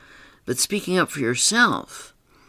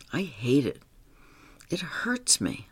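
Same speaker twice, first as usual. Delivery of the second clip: hurt whisper; 6 dB quieter than usual. Retold or imitated. Charlie confides his hurt.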